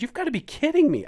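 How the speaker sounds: high, loud, fast disbelieving yell; angry cry of disbelief; retold outburst. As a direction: blow up at the guide in disbelief. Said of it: "I just completely changed".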